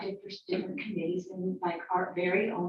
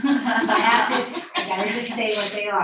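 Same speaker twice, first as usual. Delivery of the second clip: laughing out the candy's name; 3 semitones higher, 11 dB louder than usual. Real, not acted. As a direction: laugh through the words, a bit embarrassed.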